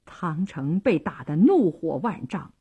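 plain reading voice

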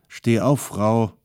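a sergeant's command. A narrator's reading. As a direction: bark at her to get up. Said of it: "sagte Sergeant Osman".

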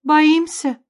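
high, loud question; startled mother, pitched well up; astonished exclamation. A narrator's reading. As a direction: throw the word back in disbelief. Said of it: "воскликнула Ванора".

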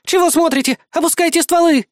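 high, urgent woman's shout; frantic cry. A narrator's reading. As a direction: cry out a desperate order. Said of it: "закричала она".

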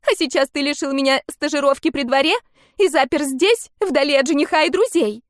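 high, loud accusing yell. Acted. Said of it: "Амелия кричала всё громче".

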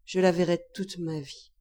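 plain reading voice

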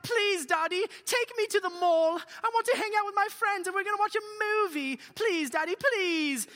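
high, loud mock begging; whiny pleading kid. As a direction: plead like a kid nagging Dad. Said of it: "whenever you guys whine and complain".

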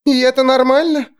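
high, thin squeak. A narrator's reading. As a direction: squeak it out nervously. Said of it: "пропищала Алекс".

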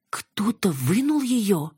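high, drawn-out shocked question; gasped question. A narrator's reading. Yana learns the tube came out, aghast. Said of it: "ахнула Яна".

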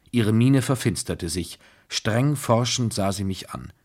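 ordinary delivery, nothing marked

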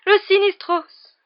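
high, loud, theatrical cry of doom; horrified exclamation. A narrator's reading. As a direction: cry it out, drawing out the last word. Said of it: "s'écria le professeur Trelawney".

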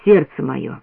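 low-pitched, subdued ache; quiet, pained. A narrator's reading. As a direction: say it low, with pain inside. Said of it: "Сказал тихо, с болью".

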